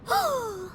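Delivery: excited gasp. Acted. Paimon gasps at the blade.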